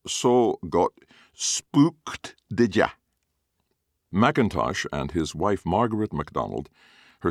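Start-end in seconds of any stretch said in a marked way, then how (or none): 0.1-2.9 teasing, high Glaswegian; Glaswegian accent. Acted. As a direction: wink it out in Glaswegian. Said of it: "added with a wink".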